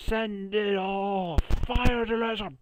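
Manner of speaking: high, loud evil commander; villain shout. Acted. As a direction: bark the order like a cartoon villain. Villain command, spam attack.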